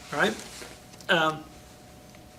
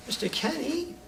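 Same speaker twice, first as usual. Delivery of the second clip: much higher, soft and hurt; a hurt, wounded question; upset kid; 11 semitones higher, 5 dB quieter than usual. Retold or imitated. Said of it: "the kid that got upset".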